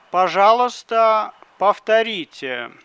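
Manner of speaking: normal talking voice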